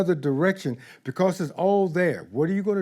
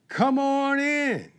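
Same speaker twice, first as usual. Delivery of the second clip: slow, high, open-armed welcome; welcoming love; 9 semitones higher, slower than usual. Pretend. Love welcoming fear at the door, slow and high. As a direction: invite them in slowly and warmly. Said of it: "Love opens the door and says".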